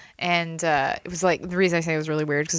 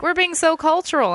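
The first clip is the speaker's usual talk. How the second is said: mock PTA mom; 9 semitones higher, 3 dB louder than usual. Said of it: "they're like".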